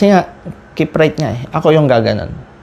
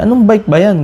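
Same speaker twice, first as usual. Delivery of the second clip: high, louder baffled question; incredulous; 8 semitones higher, 3 dB louder than usual. Retold or imitated. Other cyclist doubts the bike's price, incredulous. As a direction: sound like you can't believe it. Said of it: "sabi niya".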